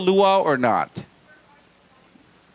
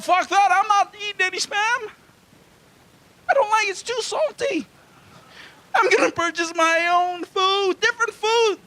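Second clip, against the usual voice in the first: very high, squeaky mock protest; mock defiant squeal; 14 semitones higher than usual. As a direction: squeal it like a hammed-up tantrum. Acted adult rebellion against childhood spam.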